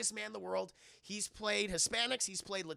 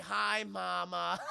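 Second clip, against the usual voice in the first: sheepish laughing greeting; much slower than usual. Retold or imitated. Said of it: "And he's like".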